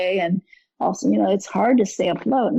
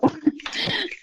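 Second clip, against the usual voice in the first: soft breathy laugh; 12 semitones higher, 5 dB quieter than usual. Real, not acted. Guest ends her topic.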